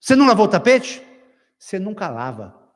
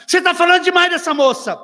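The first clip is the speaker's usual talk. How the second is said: much higher, louder scolding; jealous wife; 10 semitones higher, 4 dB louder than usual. Retold or imitated. (esposa ciumenta reclamando, voz aguda)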